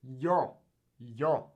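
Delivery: yo with a hard, buzzing Y start; strong Y. Shown as a demonstration. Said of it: "the strong Y".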